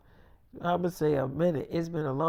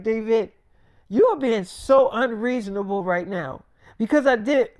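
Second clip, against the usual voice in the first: raised pleading voice; 5 semitones higher, 5 dB louder than usual. Retold or imitated. Girlfriend pleads during argument.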